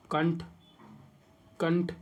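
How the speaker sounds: ordinary talk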